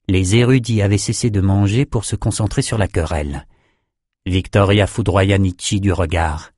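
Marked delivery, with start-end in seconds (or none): none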